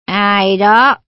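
low, slurring drowsy voice; slurred voice. Acted. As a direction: drag the words out half asleep. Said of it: "Một giọng lè nhè hỏi".

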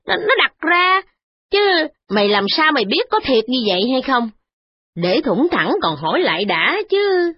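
put-on a frowning old lady brushing off the complaint; frowning old mother. Mother-in-law answering her son's wife, frowning and fretful. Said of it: "Bà cai chau mày mà đáp rằng".

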